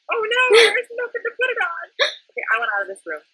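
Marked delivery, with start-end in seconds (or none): 0.1-1.9 high-pitched mock dismay; retold panic. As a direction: replay your alarm in a high voice. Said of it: "I was like".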